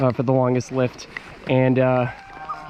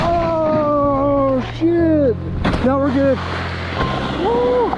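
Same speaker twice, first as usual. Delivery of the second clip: excited shouting; 11 semitones higher, much slower than usual. Real, not acted. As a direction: whoop it up on the slope. Riding down in excitement.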